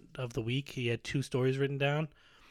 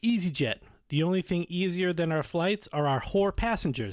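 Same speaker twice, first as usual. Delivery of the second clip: higher announcer-like tagline read; mock ad slogan; 4 semitones higher than usual. Pitching joke airline slogans to co-host, mock ad read. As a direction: sell it like a commercial. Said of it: "I got some slogans for you".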